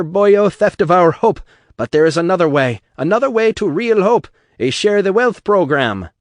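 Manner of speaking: loud, high Irish rabble-rouser; Irish brogue bellow. Acted. Atlas harangues the crowd in a loud Irish brogue. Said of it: "Atlas bellowed".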